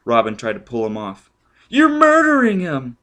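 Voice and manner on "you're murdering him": much higher, louder cry; woman screaming in horror; horrified shriek. Acted. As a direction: scream at him to stop. Robin shrieking in alarm.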